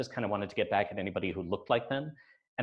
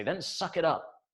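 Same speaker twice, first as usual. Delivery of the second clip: mom's curt retort; 7 semitones higher than usual. Retold or imitated. Mother voiced in childhood story.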